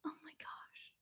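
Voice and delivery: hushed, voiceless whisper; whispered amazement. Real, not acted. Reader reacts to the book's foresight, whispering. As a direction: whisper it in disbelief.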